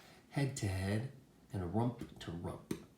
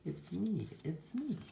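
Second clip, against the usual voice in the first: high sheep voice, rising higher; pitched-up reveal; sheep character; 9 semitones higher than usual. A narrator's reading. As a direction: announce yourself as the storybook sheep. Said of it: "says the sheep".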